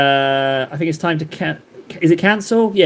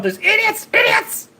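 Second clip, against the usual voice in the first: screeching idiots. Retold or imitated. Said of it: "He's like".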